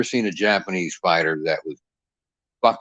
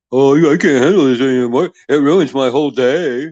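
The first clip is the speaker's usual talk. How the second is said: louder, higher mocking whine; whiny mock Chad; 4 semitones higher, 7 dB louder than usual. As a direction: imitate a weakling complaining. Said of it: "Chad got all fucking weak".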